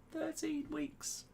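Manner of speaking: very high, slow squeaky repeat; comic falsetto, slowly stretched; mock disbelief. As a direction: draw it out in a tiny high voice. Mock disbelief at lockdown's length.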